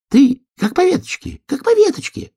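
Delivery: very high-pitched squirrel voice; high, eager little animal voice. A narrator's reading. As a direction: coach from above in a high, loud squeal. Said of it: "сказала белка".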